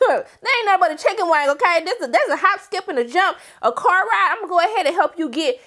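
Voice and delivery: high, fast, mocking comfort; sky-high sassy best friend. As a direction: brush off her sadness with sass. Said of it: "And she's like".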